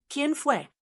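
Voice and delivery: high, furious demand; furious shout. A narrator's reading. Father yells into his daughter's face, furious. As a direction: spit the question out in rage. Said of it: "le gritaba en la cara".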